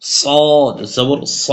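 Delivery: সা held long and slow; slow drill with a drawn-out সা; drawn-out vowel. Shown as a demonstration. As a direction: stretch the vowel in সা. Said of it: "হরকতের উচ্চারণ তাড়াতাড়ি পড়তে হবে".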